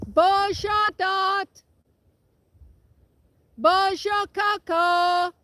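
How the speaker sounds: high, loud drawn-out French call; high cow call. Real, not acted. Farmer calling her cows in French across the wind. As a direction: sing it out to the herd. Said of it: "Let's try it again".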